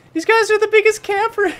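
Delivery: a shrieking high yell. Real, not acted. Streamer yelling about camping enemies, high shriek. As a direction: blow up at the campers in a screech. Bursting out at campers.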